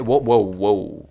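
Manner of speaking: put-on slow, drawn-out New York accent whoa. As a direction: stretch each whoa like a New Yorker. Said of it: "I can also do a New York accent".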